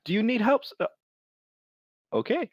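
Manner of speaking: high, amused merchant voice; a smiling shopkeeper's surprised offer. Acted. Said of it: "he's like".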